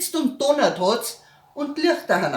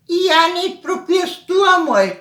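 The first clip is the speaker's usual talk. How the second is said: raised squeaky family voice; high put-on voice; 9 semitones higher, 6 dB louder than usual. Relative voiced high, passing on the job. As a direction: pitch it up high and whiny.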